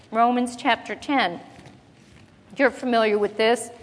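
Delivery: ordinary delivery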